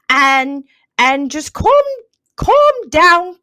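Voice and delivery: loud, high-pitched toff telling the kitties off; over-the-top English lady shooing cats from an owl; cat lady impression. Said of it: "I think she sounds something like this".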